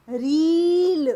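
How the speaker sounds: रील said slowly and loudly, the long ई stretched; drawn-out demo word. Shown as a demonstration. Teacher to pupils, stretching रील slowly and loudly. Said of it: "हम क्या पढ़ेंगे".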